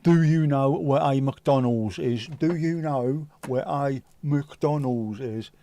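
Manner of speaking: slow, drawn-out Cockney impression; mock Cockney, every word stretched. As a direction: imitate a Cockney asking very slowly. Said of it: "a load of these cockneys".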